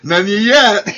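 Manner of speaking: laughing, teasing repeat; mock him through laughter; laughing mockery.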